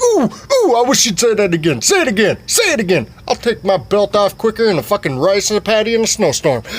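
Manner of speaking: hammed-up high daddy voice; father voice. Dad threatening with belt.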